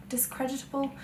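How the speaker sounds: quiet questioning echo of DISCREDITABLE; doubting the word. Real, not acted. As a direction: softly query your own word, as an aside. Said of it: "I'm just inventing words left".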